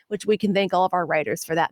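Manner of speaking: normal talking voice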